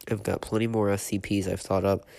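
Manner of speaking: usual speaking voice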